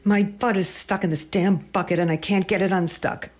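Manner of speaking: blurted out. A narrator's reading. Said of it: "Val blurted out".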